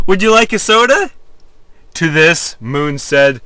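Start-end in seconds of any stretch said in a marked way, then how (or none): 0.1-1.1 rapid sing-song. A narrator's reading. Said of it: "rapid sing-song voice".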